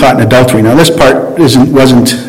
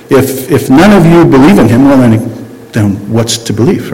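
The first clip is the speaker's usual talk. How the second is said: ordinary delivery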